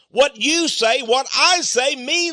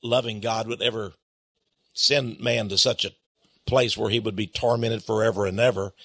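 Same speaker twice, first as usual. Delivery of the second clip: mock sceptic voice; 13 semitones lower, 6 dB quieter, faster than usual. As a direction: lower and softer mock quote of a doubter, a bit fast. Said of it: "Now, some people say".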